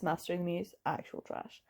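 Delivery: whispered actual trash; hushed verdict. Real, not acted. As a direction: drop it quietly, like a final judgment. Quiet dismissive verdict.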